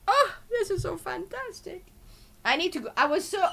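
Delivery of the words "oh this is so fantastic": high, delighted gush; thrilled. Retold or imitated. Watching the press conference, a high delighted gush. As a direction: squeal it with glee. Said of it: "I was like".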